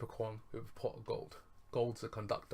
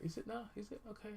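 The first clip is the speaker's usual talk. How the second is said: put-on squeaky girl voice; 11 semitones higher, 4 dB quieter than usual. Leela voiced in retold scene.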